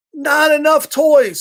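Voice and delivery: a picky bird pouting about toys; pigeon complaining. Acted. Picky pigeon in the bath book, slow pouty whine.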